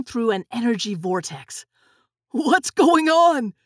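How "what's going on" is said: slow, high, loud holler; bewildered shout. Acted. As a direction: yell it over the roaring vortex. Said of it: "Nick hollered".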